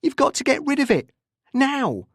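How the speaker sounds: fast, high, urgent order; angry demand. A narrator's reading. Anderson pressing Tim on the phone, angry demand. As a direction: demand it, voice pitched right up.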